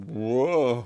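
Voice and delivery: lightly hammed-up startled whoa; mock stagey whoa. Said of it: "everyone just goes".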